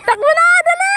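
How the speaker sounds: high, loud urging cheer; excited shout. Real, not acted. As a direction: yell him on to run. Urging a child to run.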